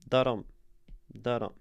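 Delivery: "Da-dum" as a weak-STRONG pulse, pause between each; iambic beat. Shown as a demonstration. Teacher showing the repeated structure of a poem, iambic beat. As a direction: sound out "da-dum" with the weight on "dum". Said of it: "the repeated structure within the poem".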